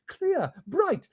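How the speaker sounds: plain reading voice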